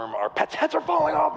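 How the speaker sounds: high-pitched comic movie-quote voice; Dumb and Dumber. Acted. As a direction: blurt it as a silly, panicked film line. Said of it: "It's like a Dumb and Dumber".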